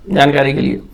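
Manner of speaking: ordinary delivery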